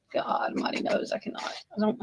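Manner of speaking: overwhelmed breathy whisper. Real, not acted. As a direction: whisper it in despair. Overwhelmed by the news.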